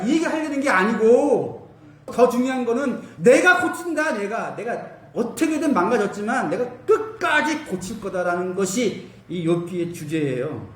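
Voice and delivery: usual speaking voice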